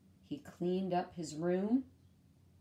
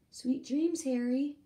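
higher, sweeter toy voice; sweet high goodnight; 6 semitones higher, 3 dB louder than usual. Acted. Lula wishes Harry good night in a high sweet voice.

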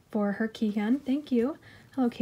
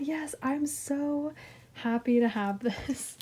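high, slowed-down gush of joy; gushing delight; 4 semitones higher, slower than usual. Real, not acted. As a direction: melt over the card you wanted. Opening a longed-for card.

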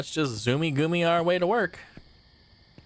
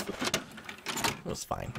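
whispering; 11 dB quieter, much slower than usual. Real, not acted. Nervous self-reassurance.

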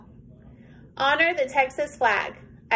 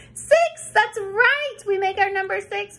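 excited; 7 semitones higher, 3 dB louder than usual. Real, not acted. Excited praise to class.

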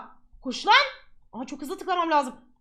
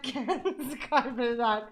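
laughing; 4 semitones lower, 6 dB quieter, slower than usual. Real, not acted. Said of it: "Bak çok açık ve net konuşuyorum".

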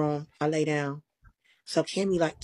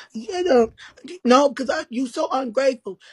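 indignant retort; 5 semitones higher, 5 dB louder than usual. Retold or imitated. Quoting Cammy's indignant retort.

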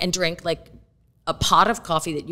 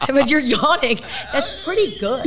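normal talking voice

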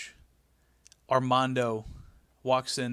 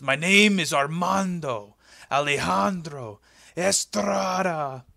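put-on slow, louder, drawn-out Spanish accent announcing the name; grand mock Spanish accent; 3 semitones higher, 5 dB louder, slower than usual. Estrada repeating his name for Vince, grand mock Spanish accent. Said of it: "And he goes".

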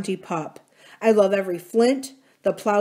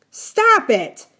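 sudden high, loud shout; furious shout, much higher and louder; 10 semitones higher, 7 dB louder than usual. A narrator's reading. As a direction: explode at him to make him stop. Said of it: "Adam shouted at him".

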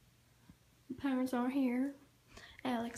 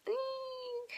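mock squeal; 13 semitones higher, 5 dB quieter than usual.

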